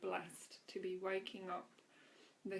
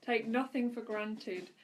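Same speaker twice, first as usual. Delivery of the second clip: louder, emphatic inspirational reading; devotional reading; 7 dB louder than usual. Acted. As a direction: read each line as an uplifting message to the listeners. Reads daily devotional aloud.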